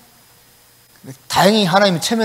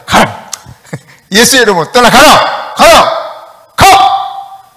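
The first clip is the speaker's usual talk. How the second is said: climbing higher and louder to a strained cry; mock exorcist yell; 6 semitones higher, 9 dB louder, much slower than usual. Preacher reenacting his own turn commanding the demon, hammed-up yell. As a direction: shout the demon out, harder each time. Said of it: "그래도 내가 외쳤단 말이에요".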